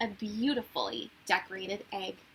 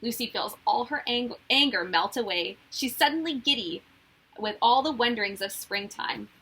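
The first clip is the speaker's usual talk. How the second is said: ordinary delivery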